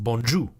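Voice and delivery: 'bonjour' as bon-joo, R dropped; silent final R. Shown as a demonstration. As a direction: end 'bonjour' on an oo with no R. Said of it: "we were taught that it was pronounced".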